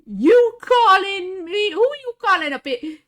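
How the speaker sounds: loud, high, indignant movie-quote voice; Lion King impression. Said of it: "All I can think of is Lion King".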